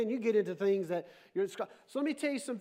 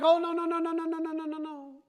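mock panicked no; 5 semitones higher than usual. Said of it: "And we're like".

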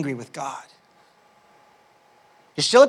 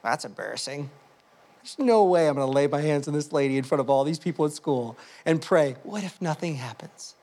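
embarrassed inner thought; 6 semitones lower, 3 dB quieter than usual. Retold or imitated. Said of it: "the first thought I had".

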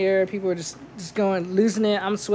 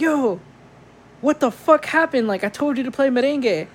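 put-on drunk angry demand; 6 semitones higher than usual. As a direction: snap at the DJ like a drunk guest. Drunk client yells at DJ.

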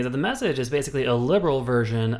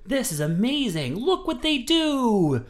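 much higher, awestruck put-on voice, slowing right down on the last line; mock gushing idealist; 11 semitones higher, slower than usual. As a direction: gush like a starry-eyed student. Mocking gushing college idealist.

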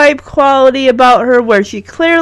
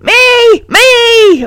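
high, eager squeals of a pupil; mock screaming; 14 semitones higher, 4 dB louder, much slower than usual. A narrator's reading. Margot with her hand in the air, mock screaming. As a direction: squeal to be picked, each word separate. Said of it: "with a hand in the air screaming".

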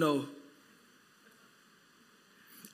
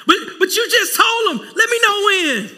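exasperated shout; 10 semitones higher, 6 dB louder than usual. Real, not acted. Scolding the fake promise.

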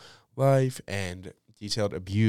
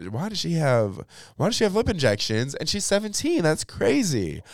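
higher, mock disbelief; incredulous; 9 semitones higher, 3 dB louder than usual. Questioning the actress's lip injections, higher mock disbelief. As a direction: scoff in disbelief. Retells own incredulous thought.

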